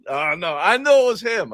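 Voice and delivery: put-on high, loud Russian-accented wrestler voice; Nikolai voice. Retelling a prank call, high loud Nikolai voice. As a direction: mimic the old friend answering the phone. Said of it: "And he said".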